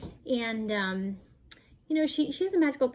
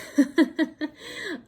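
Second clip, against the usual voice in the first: laugh; 4 semitones higher, 4 dB louder than usual. Real, not acted. Laughs at her own joke.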